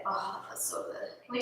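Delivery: relieved whisper. Real, not acted. Relaxing into a good stretch.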